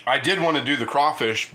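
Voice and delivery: ordinary delivery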